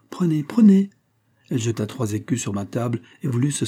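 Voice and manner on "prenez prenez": high, louder pressing plea; urgent insistence. A narrator's reading. Pauline presses money on Raphaël, urgently insisting. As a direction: urge it on him, voice lifting high. Girl urges money on him.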